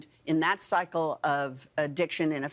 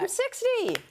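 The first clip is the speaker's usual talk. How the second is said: high, exultant squeal; giddy excitement. Real, not acted. Guest proclaims being sixty to the host, exultant and high. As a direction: crow it proudly, pitched way up.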